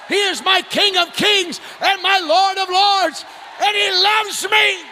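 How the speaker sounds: loud shouted praise preaching; preacher shouting his love for the Lord. Acted. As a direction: proclaim each line to the church.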